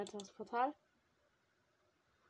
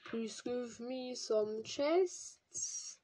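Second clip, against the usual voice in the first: slow, stretched pleading for loot; drawn-out begging; 5 semitones higher, 10 dB louder, much slower than usual. Pretend. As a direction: beg the game slowly and loud. (langgezogenes Betteln)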